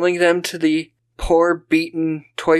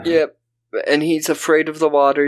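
ordinary talk, nothing marked

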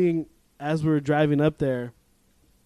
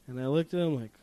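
ordinary talk, nothing marked

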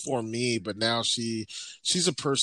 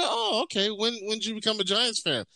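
much higher, mock-innocent questioning; probing with raised eyebrows; skeptical; 10 semitones higher than usual. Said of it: "I go".

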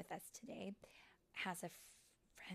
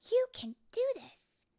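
high, loud pep talk; emphatic encouragement; 16 dB louder, slower than usual. Acted. Silver Mist urging Tinker Bell to retry, encouraging. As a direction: cheer your friend on loudly.

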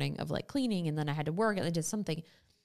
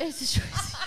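laughing; 7 semitones higher, slower than usual. Real, not acted. Repeats sister's line while laughing.

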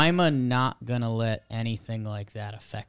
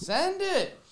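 high hyped bro shout; frat bro. Acted.

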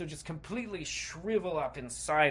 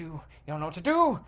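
quick, louder burst of alarm; rising panic; 8 dB louder, faster than usual. A narrator's reading. Cornered player with knives closing in, panicked. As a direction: blurt it out, spiking on the last word.

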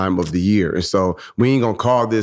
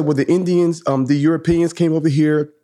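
put-on higher-pitched child reciting a lesson; excited third grader; 6 semitones higher than usual. Daughter retelling her history lesson to dad, lightly excited. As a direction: imitate a daughter eagerly reporting. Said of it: "So she was excited".

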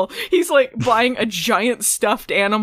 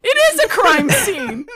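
mocking retort; 11 semitones higher, 6 dB louder than usual.

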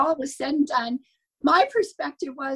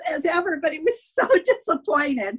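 usual speaking voice

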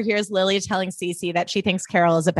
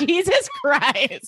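slow, higher drawn-out "Jesus Christ"; exasperated; 8 semitones higher, much slower than usual. Retold or imitated. A viewer overwhelmed by melodrama, slow and exasperated. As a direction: stretch it out in mock despair. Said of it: "I was like".

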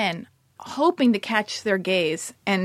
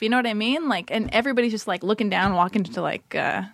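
normal talking voice

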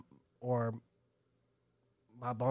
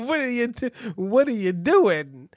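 mock Yiddish accent; 7 semitones higher, 8 dB louder than usual. Continuing Yiddish bit.

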